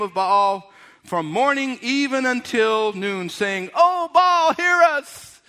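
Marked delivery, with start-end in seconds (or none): 3.7-5.4 loud, higher mock cry to an idol; pleading prophets. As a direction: cry out to a silent god. Prophets calling on Baal.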